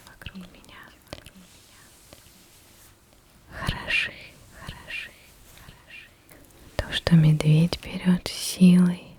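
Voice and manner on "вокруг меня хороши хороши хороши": barely audible. Real, not acted. Repeating, fading into a whisper.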